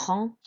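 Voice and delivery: "ren" with a nasal French en vowel; nasal en sound. Shown as a demonstration. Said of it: "so that's an en sound".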